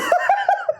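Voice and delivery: high shrieking laugh; real helpless laughter. Real, not acted. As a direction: crack up at the screen. Laughs at the AI story.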